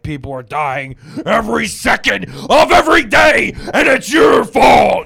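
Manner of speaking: high, mounting full-voice yell; alarmist news shouting. Acted. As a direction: bellow it like a doom-mongering newscaster, dragging out the end. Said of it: "You watch the news, they're like".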